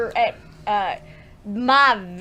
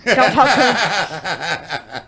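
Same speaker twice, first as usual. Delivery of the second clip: Don't talk to him stretched very slow with a laugh; drawn-out laughing repeat; 6 semitones lower, much slower than usual. Retold or imitated. Repeating the warning to herself, slow and laughing. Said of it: "And I'm like".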